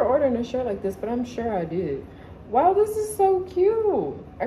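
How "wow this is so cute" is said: high, slow gushing delight; excited. Real, not acted. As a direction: gush over it, drawn out. Excited unboxing a cute shirt.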